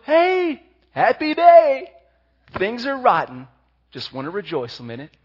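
high, loud mock-cheerful child; mock cheery kid. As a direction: sarcastically play a kid who loves bad days. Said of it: "they just go".

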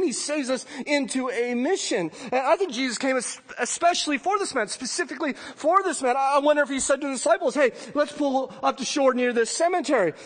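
loud, ringing sermon voice; preaching at full force. Acted. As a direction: drive it home to the congregation. Preaching climax, raised voice.

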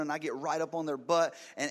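usual speaking voice

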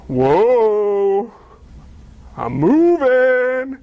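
long-drawn, astonished words; awed discovery. Acted. Paraplegic finding his body works, drawn-out amazed wonder. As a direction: stretch each word out in wonder.

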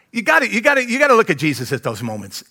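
ordinary delivery

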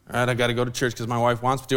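low, fast, grudging mumble; dragged-along husband. Retold or imitated. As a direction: imitate a reluctant husband giving in. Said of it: "husband that the wife drags along".

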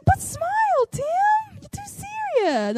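very high, drawn-out coaxing; happy lady. Retold or imitated. Happy lady insisting the owner cheer up, high sing-song imitation. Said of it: "And she's like".

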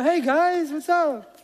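high, loud, put-on cheery hello; cheery boss greeting. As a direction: breeze in like the oblivious boss. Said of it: "I go".

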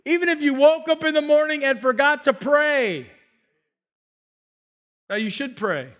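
usual speaking voice

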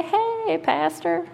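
a high, sing-song "Hey, pastor" from a friendly churchgoer; mock delighted shopper spotting their pastor; happy greeting. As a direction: call out a glad hello to the pastor in the store. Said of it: "people are happy to see me and they say".

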